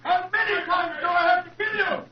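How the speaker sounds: enraged yelling at his victim; a killer's frantic cry of hate; furious shout. Acted. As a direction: scream it out in murderous rage. Murderer attacks Holmes in dressing room.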